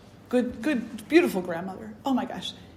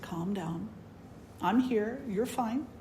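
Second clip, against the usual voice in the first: much softer comforting voice; calm her down gently; soothing grandma; 9 dB quieter, slower than usual. Retold or imitated. Grandmother comforts frightened child.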